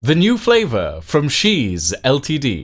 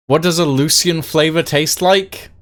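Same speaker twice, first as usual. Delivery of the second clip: ordinary talk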